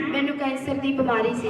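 speaking up. Real, not acted. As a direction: repeat it up for the congregation. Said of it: "ਉੱਚੀ ਬੋਲ ਕੇ ਦੱਸੋ". (ਉੱਚੀ ਬੋਲ ਕੇ ਦੁਹਰਾਉਣਾ)

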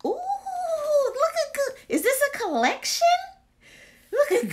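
very high, squealing delight; excited squeal. Real, not acted. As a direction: gush over the new find. Excited at new candle collection.

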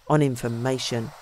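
low, soft, gloomy mutter; gloomy murmur. A narrator's reading. Dominic murmurs to the young man, gloomy and low. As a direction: drop the chin and murmur darkly. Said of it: "he murmured, in a gloomy voice".

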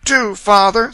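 put-on high, loud girl's entreaty; pleading girl. A narrator's reading. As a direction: beg father with a rising lilt. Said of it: "began to plead timidly for their brother".

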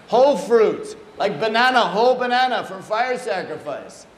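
insistent correction. Retold or imitated. Guru rejecting the sliced fruit tray, insistent correction.

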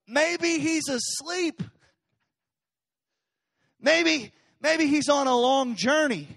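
high, slow mock sympathy; hammed-up mocking sarcasm; mocking taunt. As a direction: tease them slowly, eyebrows up. Elijah mocking the prophets.

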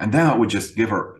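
ordinary delivery

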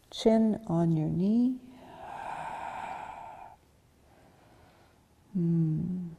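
soft, whispered resting cues; soft yoga guidance. Acted. Yoga teacher guides class through rest, soft and whispery. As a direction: breathe the cues out gently. Calm cues in forward fold.

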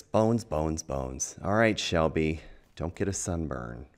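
ordinary delivery

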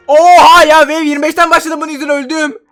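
loud, high exasperated cry; a loud, high-pitched wail of disbelief; frustrated outburst. Real, not acted. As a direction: blurt out your outrage at dying. Dies because of teammate.

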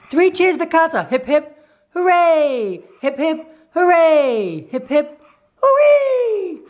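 high, loud hip hip hooray; rally the room with cheers; cheering. Real, not acted. Cheering for dog's birthday.

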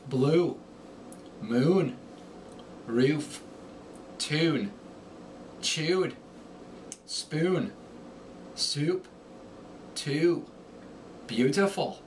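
Irish accent, long U lifted in pitch. Shown as a demonstration. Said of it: "The long U rises in pitch".

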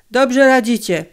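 loud, high joyful praise; delighted. A narrator's reading. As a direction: burst out with relief and joy. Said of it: "uradował się Konderla".

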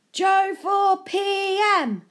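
slow, high, loud chant-like shout; crowd shouting. A narrator's reading. Mob carrying Joe home, shouting it slowly and high. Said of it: "they were all shouting".

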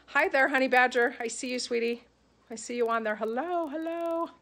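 high, cooing hello; cooing greeting. Real, not acted. Greeting a viewer called honey badger, high and cooing.

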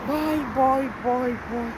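loud, high farewell call; calling goodbye. Real, not acted. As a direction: call it out to everyone as you leave. Signing off to viewers.

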